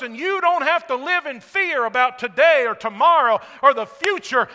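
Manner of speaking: loud, full-force preaching to the church; preaching shout. Real, not acted. Pastor shouting to the congregation about Jesus enthroned, loud. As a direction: proclaim it at full voice from the pulpit. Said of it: "I've been yelling and shouting".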